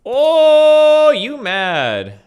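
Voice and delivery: high, loud teasing oh; mocking tease. Real, not acted. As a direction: taunt him playfully. Teasing after friend yells.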